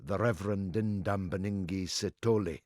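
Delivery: "Ndambaningi Sithole" rolled out fluently, low and soft; showing off pronunciation. Shown as a demonstration. Host proving he could pronounce the name, fluent pronunciation. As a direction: say "Ndambaningi Sithole" in one practised, unbroken run, quietly. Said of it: "could pronounce".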